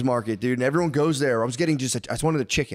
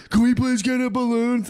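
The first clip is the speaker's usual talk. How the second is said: put-on much higher, louder begging child; whiny kid voice; 11 semitones higher, 4 dB louder than usual. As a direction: beg like a small kid for a balloon. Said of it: "They're like".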